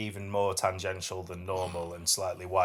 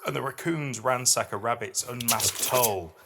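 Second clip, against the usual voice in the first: higher, louder, measured poem recital; poetry reading; 3 semitones higher, 3 dB louder than usual. Acted. Podcast host reads a dark fairy-tale poem to her co-host, recited with weight. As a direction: read it like a performed poem, each image weighed.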